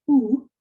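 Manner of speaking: oo, a pure rounded oo sound; oo vowel. Shown as a demonstration. Teacher demonstrating the Boeotian oo vowel. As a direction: sound out oo slowly. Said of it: "omicron upsilon".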